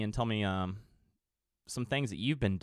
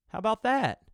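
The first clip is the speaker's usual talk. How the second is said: delighted; 12 semitones higher, 4 dB louder than usual. Real, not acted. Reacting to good news.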